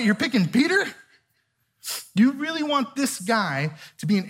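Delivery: really can't believe the choice; incredulous. Retold or imitated.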